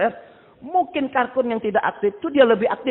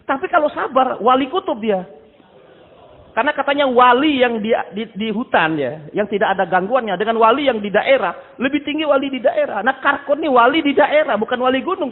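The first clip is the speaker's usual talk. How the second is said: higher and louder, driving the point home to the congregation; forceful preaching; 5 semitones higher, 6 dB louder than usual. Acted. Preacher urging the congregation about tablik, forceful preaching.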